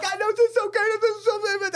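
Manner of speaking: answering the crying star, hammed up; mock tearful gushing. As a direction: share the moment, choked up. Narrator's emotional reply.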